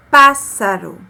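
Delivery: pássaro said loud and clear with a hissing ss; careful demo word. Shown as a demonstration. Teacher showing pupils the word, loud hissing ss. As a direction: spell out the sharp S sound in pássaro. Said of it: "Olha ele aqui".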